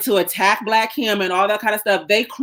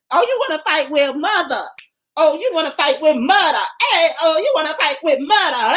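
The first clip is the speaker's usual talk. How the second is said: taunting; 7 semitones higher than usual. Pretend. Taunting critics as mother.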